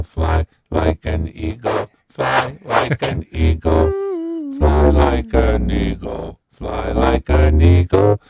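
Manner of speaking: deep, slow vocoder voice. Shown as a demonstration. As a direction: drone it like a robot. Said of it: "Turn the vocoder on".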